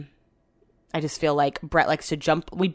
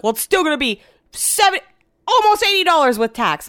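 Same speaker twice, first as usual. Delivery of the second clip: whiny husband voice; 10 semitones higher, 4 dB louder than usual. Retold or imitated. Husband complaining about price.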